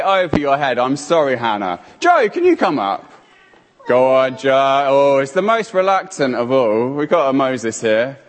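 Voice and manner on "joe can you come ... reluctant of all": usual speaking voice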